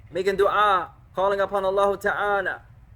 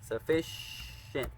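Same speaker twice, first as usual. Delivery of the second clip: slow model word; 3 semitones lower, 13 dB quieter, much slower than usual. Shown as a demonstration. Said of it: "means sufficient".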